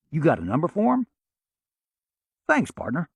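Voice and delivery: Oklahoma twang, folksy and friendly; Oklahoma accent. Acted. Sam to the insurance agent, lightly put-on Oklahoma accent. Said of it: "spoke in an Oklahoma accent".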